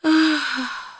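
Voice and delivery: put-on high girlish sigh of disappointment; dejected child's sigh; sad sigh. A narrator's reading. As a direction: let out a high, sorrowful sigh. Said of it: "Vzdychla Ela smutně".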